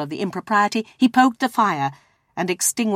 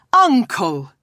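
raised, imploring protest; pleading; 5 semitones higher, 3 dB louder than usual. A narrator's reading. Nephew protesting to his uncle, pleading. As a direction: appeal to him in one falling word. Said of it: "pleaded the nephew".

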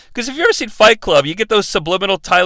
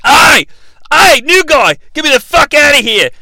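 loud, high Australian bellow; Australian accent; 7 semitones higher, 5 dB louder than usual. Retold or imitated. Host plays the chained captive yelling, mock Australian accent. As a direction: holler it like a brash Australian. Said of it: "Australian famously, and he's like".

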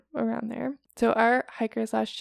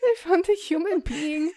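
higher mock German voice; a hint of a German hiker; 7 semitones higher than usual.

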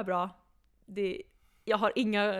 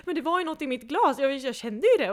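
panicked insisting; 5 semitones higher, 9 dB louder than usual. Retold or imitated. Insisting something was in drink.

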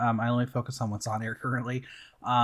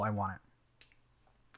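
ordinary talk, nothing marked

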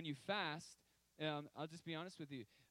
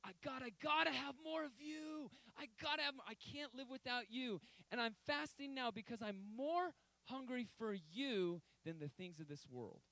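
fervent cry to God, much higher and louder; passionate plea; 9 semitones higher, 3 dB louder than usual. Retold or imitated. Fasting believer crying out to God for more, impassioned. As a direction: plead with longing, voice lifted high. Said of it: "saying no more to the ceiling and saying".

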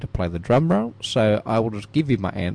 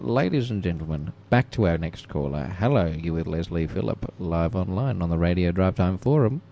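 radio announcer; 4 semitones lower than usual. Acted. Radio host announcing caller.

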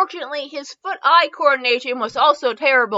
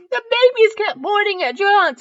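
high, strained after a real laughing fit; resume reading with laughter still in the voice; recovering from laughter; 9 semitones higher than usual. Real, not acted.

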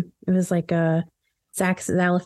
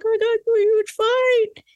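high boastful attention-seeker; bragging friend; 13 semitones higher than usual. Retold or imitated. Host imitating a friend who claims a fight, boastful. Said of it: "was like".

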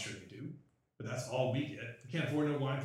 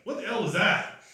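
startled; 7 semitones higher, 9 dB louder than usual. Acted. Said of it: "the guy coming in is like".

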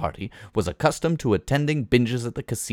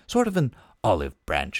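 plain reading voice

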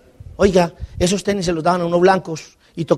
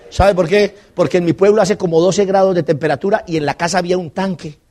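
normal talking voice

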